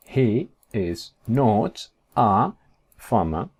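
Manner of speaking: very slow, separated words; one word at a time, with pauses; drawn-out, careful English; slow model sentence. Shown as a demonstration. Teacher models negative sentence slowly.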